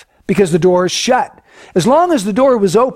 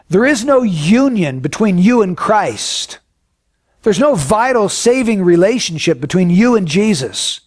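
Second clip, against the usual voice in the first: usual speaking voice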